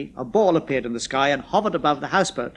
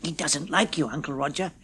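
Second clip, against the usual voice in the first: quiet aside; 2 semitones lower, 6 dB quieter than usual. A narrator's reading. Ronnie whispering aside.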